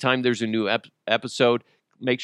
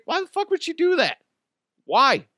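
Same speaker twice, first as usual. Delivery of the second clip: disbelieving cry, way higher; baffled outburst. Real, not acted. Host baffled by the fake student, disbelieving outcry. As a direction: throw up your hands in disbelief.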